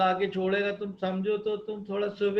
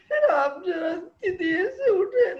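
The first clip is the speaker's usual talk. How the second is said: whining wife; 8 semitones higher, 6 dB louder than usual. Acted. Sick wife complains to husband.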